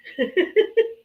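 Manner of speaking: laughing though it hurts; laugh, wince at the pain. Real, not acted. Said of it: "it hurts to laugh".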